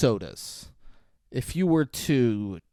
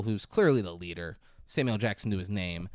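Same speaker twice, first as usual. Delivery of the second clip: ordinary talk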